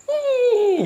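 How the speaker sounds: very high, drawn-out mock cackle; imitated giggle. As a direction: squeal out an eerie woman's titter. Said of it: "suara cekikikan".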